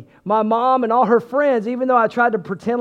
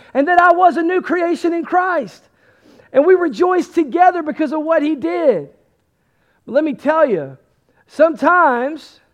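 building, higher-pitched sermon climax; impassioned preaching; 4 semitones higher, 5 dB louder than usual. Real, not acted. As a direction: drive each phrase home to the congregation.

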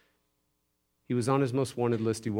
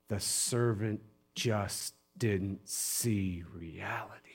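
slow, soft, near whisper; hushed emphasis; 3 semitones lower, 6 dB quieter, slower than usual. Real, not acted. Preacher on the servant's blindness, slow and hushed. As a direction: let each word land slowly and softly.

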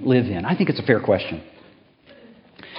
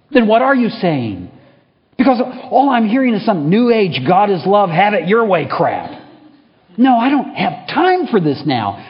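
much higher, loud furious tirade; intense anger; 9 semitones higher, 7 dB louder than usual. Acted. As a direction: rant with rising fury. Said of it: "Growing intense".

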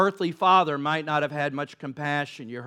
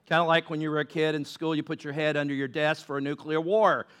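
normal talking voice

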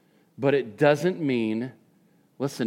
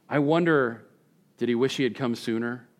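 ordinary talk

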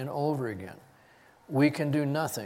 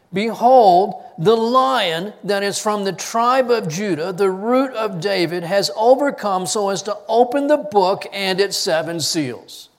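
stern proclamation; 5 semitones higher, 7 dB louder than usual. A narrator's reading. Elder announcing the Lion of Judah to John, loud and stern. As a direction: declare it with raised solemn force. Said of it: "he has to be sternly reminded".

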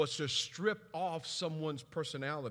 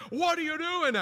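higher, louder alarmed question; alarmed wife; 10 semitones higher, 6 dB louder than usual. Retold or imitated. Wife waking to her husband on the phone at midnight, alarmed. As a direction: wake up scared and demand what's happening. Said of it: "She's freaking out".

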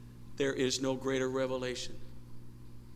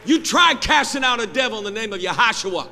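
high, very loud shouted sermon, building in intensity; full-force preaching; 14 dB louder than usual. Real, not acted. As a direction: shout it out to the whole congregation.

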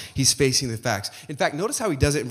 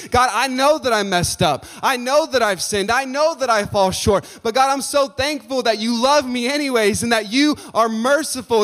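higher, louder, confessing with feeling; humble heartfelt prayer; 9 semitones higher, 7 dB louder than usual. Retold or imitated. Preacher voicing a contrite prayer, higher and moved. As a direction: pour out a humble thank you.

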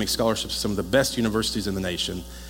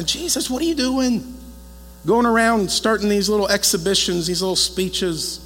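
higher, louder, nagging relative; exasperated family; 10 semitones higher, 4 dB louder than usual. Retold or imitated. Family scolding Jesus over his speeches, mock nagging. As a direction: scold him like an exasperated aunt.

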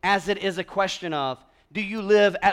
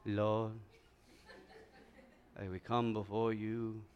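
hushed, much lower mock prayer voice; put-on prayer voice; 10 semitones lower, 15 dB quieter, slower than usual. Preacher showing the congregation their prayer voice, mock-solemn and hushed. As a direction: drop low and soft into solemn, performed piety. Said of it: "some of us even have a prayer voice".

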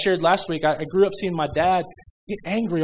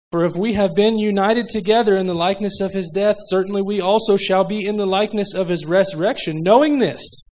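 fervent preaching; 3 semitones higher, 4 dB louder than usual. Acted. Preaching at full force.

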